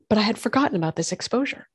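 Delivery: usual speaking voice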